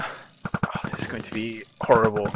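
ordinary talk

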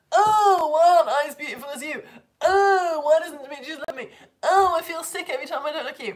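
whingy Helena. Retold or imitated. Teacher mocking Helena's lines to her class, in a whiny voice. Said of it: "'cause she's so whingy".

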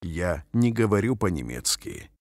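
usual speaking voice

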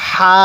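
long level হা on an audible breath; airy হা. Shown as a demonstration. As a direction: say হা with a light stream of air from the mouth. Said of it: "মুখ দিয়ে হালকা বাতাস বের করব".

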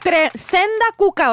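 agudo, fuerte y lento, exagerado; piropo vulgar. Pretend. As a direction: lanza el piropo grosero con exageración. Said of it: "así sería horrible". (piropo grosero, alto y fuerte)

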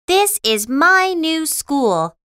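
high, insistent announcement; delighted Patty. Acted. Patty proudly insists on school.

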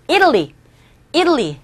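"Italy" with a strong first syllable, then falling; front-stressed "Italy" for repeating. Shown as a demonstration. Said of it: "첫 번째 강세 주시고 따라하세요".

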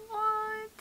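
ordinary talk, nothing marked

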